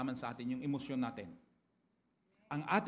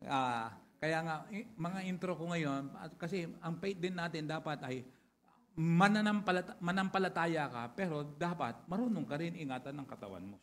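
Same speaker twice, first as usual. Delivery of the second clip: usual speaking voice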